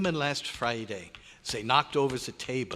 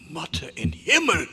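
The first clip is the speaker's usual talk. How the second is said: loud high German oath; shocked exclamation; 7 semitones higher, 6 dB louder than usual. Acted. Doctor sees the mass, crying out in German. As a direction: blurt it out in alarm.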